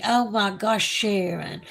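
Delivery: ordinary talk, nothing marked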